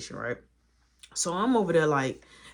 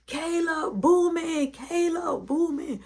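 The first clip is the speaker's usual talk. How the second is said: much higher, calling across the house; 12 semitones higher, slower than usual. Retold or imitated. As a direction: call his name searching room to room. Searching house calling boy.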